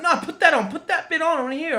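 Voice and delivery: put-on high, loud, insistent child-like plea; obsessed kid demanding. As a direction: re-enact an obsessed kid's urgent demand. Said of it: "I'm like".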